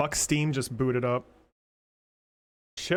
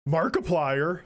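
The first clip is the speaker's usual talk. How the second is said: ordinary talk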